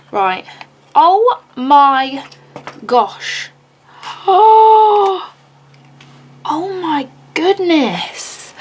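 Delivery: normal talking voice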